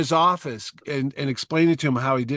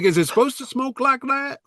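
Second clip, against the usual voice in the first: high incredulous customer voice, pitched up; mock puzzled customer; 11 semitones higher than usual. Mocking customers asking the salesman about smoke. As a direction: play a baffled buyer.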